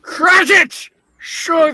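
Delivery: high loud bark of a name; calling out. Acted. Scrooge summoning his clerk, high loud call. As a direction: yell for the clerk across the office.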